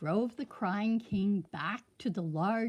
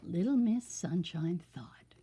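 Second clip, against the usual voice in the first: ordinary delivery, nothing marked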